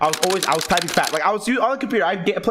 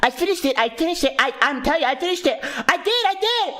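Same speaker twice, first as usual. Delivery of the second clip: insistent, higher repeated protest; arguing kid; 7 semitones higher than usual. Retold or imitated. Kid arguing with the teacher, insistent and higher. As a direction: keep insisting, climbing higher each time. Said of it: "I was arguing with this hoe".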